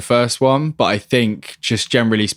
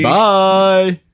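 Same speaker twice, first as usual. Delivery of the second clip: shouting underwater; 8 semitones higher, 4 dB louder than usual. Pretend. Podcast sign-off, co-host.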